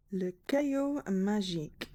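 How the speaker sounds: slow, deliberate French reading; French accent. Shown as a demonstration. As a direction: say it slowly in amateur French. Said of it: "Sorry, my atrocious French accent".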